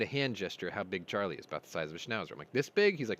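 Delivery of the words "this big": high, louder "This big?"; hopeful question. Retold or imitated. Showing the driver Charlie's size, an eager high question. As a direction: check it eagerly, pitch jumping up.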